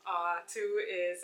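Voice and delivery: normal talking voice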